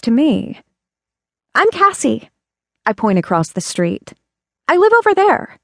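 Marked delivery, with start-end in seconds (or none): 1.5-2.3 put-on much higher, eager childlike voice; a small girl telling her name; little girl voice. Little girl introducing herself. 4.7-5.6 put-on much higher, eager childlike voice; a small girl telling her name; little girl voice. Little girl pointing at home.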